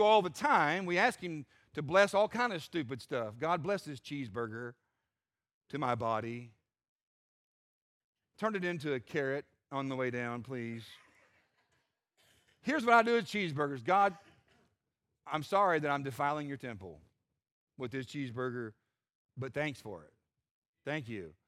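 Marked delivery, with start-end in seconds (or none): 3.4-10.8 lower, softer mock-pious prayer. Preacher parodying believers blessing junk food, mock pious. 13.8-21.3 lower, softer sheepish apology to God; a guilty eater mumbling thanks; sheepish mock prayer. Preacher showing his own cheeseburger prayer, mock sheepish.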